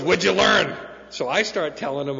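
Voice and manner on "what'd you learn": loud, high-pitched demanding question; raised-voice old-timer putting him on the spot; imitated demand. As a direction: fire the question out like a challenge.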